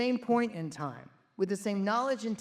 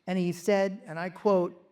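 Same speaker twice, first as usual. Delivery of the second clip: ordinary delivery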